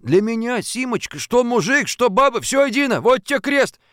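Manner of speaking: put-on man sucking up to a girl; fawning suitor. A narrator's reading. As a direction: swear it earnestly to win her back. Fadeykin flatters Simka.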